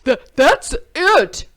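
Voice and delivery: loud, stammering outburst; exasperated cry. A narrator's reading. Jim fed up with the animals, loud outburst. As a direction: burst out, fed up. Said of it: "cried Jim".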